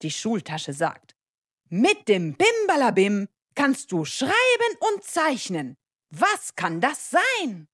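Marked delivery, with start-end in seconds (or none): 1.7-7.7 high, emphatic quiz-giver; riddling magic schoolbag. Acted. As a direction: pose the riddle in a lively, singsong raised voice. (Rätsel, hoch und betont gestellt)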